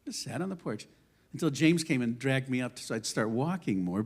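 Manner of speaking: normal talking voice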